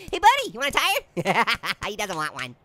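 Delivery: ordinary delivery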